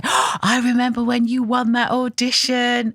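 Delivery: fan in the street. Retold or imitated. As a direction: lightly voice a gushing fan. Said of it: "people come up to me in the street and say".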